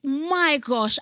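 high, loud exasperation; mock despair. Woman sick of her misspelt surname, mock despair. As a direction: exclaim it as if at wits' end. Pleading to be married out of name.

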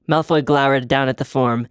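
ordinary delivery

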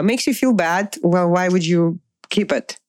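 normal talking voice

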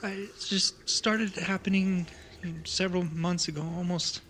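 lightly voiced frightened kid, halting and stammering; a young survivor stumbling through the story; grieving child tells of the attack; scared child.

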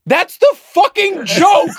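high, loud, drawn-out comic exasperation; shrieked protest at missing the joke; exasperated yell. Real, not acted. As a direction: shout it slowly, at the end of your patience. Exasperated at repeated corrections.

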